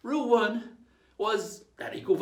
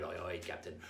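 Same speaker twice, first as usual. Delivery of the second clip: quiet; 12 semitones lower, 11 dB quieter than usual. Shown as a demonstration. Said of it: "That's good".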